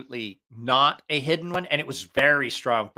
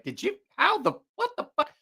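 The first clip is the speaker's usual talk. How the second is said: shocked high squeal; 14 semitones higher than usual. Retold or imitated. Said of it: "You're like".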